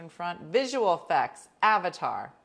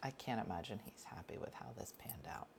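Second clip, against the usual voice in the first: whispered, much softer, like sharing gossip; confiding whisper; 7 semitones lower, 16 dB quieter than usual. Real, not acted. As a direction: lean in and whisper it. Gossipy aside about Tom Cruise.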